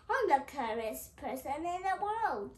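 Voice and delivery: loud, proud boast; boastful loud. Acted. Mister Clever boasting loudly to Mister Greedy. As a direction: shout your cleverness to the world.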